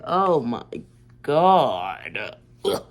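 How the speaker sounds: loud, drawn-out oh my God; retold oh-no realization; exclaimed dismay. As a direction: belt it out in disbelief. Retells dawning realization.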